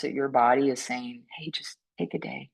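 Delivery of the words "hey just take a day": very softly voiced suggestion; soft inner nudge. Your body telling you to rest, soft nudge. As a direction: drop right down to a small, quiet prompt. Voices the body's small signal.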